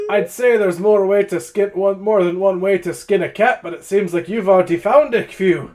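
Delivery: loud, high-pitched dwarf banter; dwarf challenger. Acted. Dwarf needling a drinking rival, loud challenger. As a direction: project it boldly, voice pushed up. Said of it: "He looks right to you".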